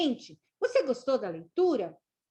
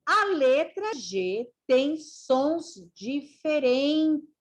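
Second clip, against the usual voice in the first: slow, drawn-out syllables, higher pitch; slow teaching read-out; 4 semitones higher, slower than usual. Shown as a demonstration. Teacher reading to learners that letter G has different sounds, slow and deliberate. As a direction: read it out slowly, stretching each word.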